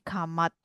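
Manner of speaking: "come out" said as pidgin "kamat"; pelafalan pidgin. Shown as a demonstration. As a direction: run "come out" together into "kamat". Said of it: "Ngomongnya jadi". ("come out" dilafalkan "kamat" ala pidgin)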